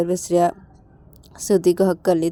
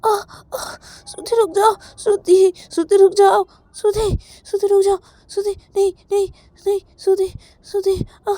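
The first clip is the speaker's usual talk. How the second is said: high, loud, tearful pleading; begging a friend to come to her senses, voice breaking; pleading and crying; 12 semitones higher, 4 dB louder than usual. Acted. A panicked girl pleads with her friend, crying.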